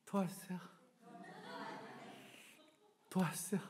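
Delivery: very soft, timid plea; small-voiced beggar. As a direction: beg meekly, barely audible. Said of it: "작아요". (작은 목소리로 구걸하는 거지 흉내)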